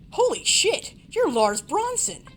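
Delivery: high, loud, slow starstruck exclaim. Acted. Player's character spotting Lars Bronson, starstruck shout. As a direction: gasp out his name like a fan.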